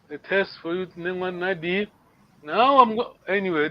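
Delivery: ordinary talk, nothing marked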